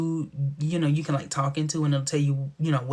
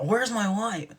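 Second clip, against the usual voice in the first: higher, half-hearted mock demand; mocking Leo imitation; 5 semitones higher, slower than usual. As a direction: mimic him mockingly, pitched up. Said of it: "Trying to be somebody".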